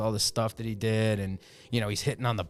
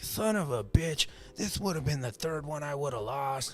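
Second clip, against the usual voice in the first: Ric Flair; 3 semitones higher, 5 dB quieter, slower than usual. Retold or imitated. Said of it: "He's like".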